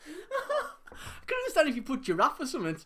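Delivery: high-pitched, loud protest through giggles; laughing disbelief. Real, not acted. Quizmaster reasoning over partner's silly answer, laughing disbelief. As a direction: argue while cracking up.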